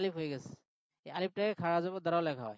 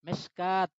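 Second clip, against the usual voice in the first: usual speaking voice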